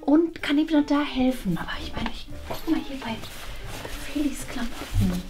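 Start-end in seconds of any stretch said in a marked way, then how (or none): 1.6-5.2 conspiratorial whisper to herself; a quiet scheming whisper; sneaky whisper. Acted. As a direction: whisper it like she's up to mischief. Secretly raiding daughter's clothes.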